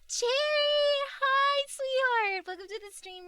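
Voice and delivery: cute anime streamer. Acted. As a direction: coo a sweet high hello. Greeting a raider on stream.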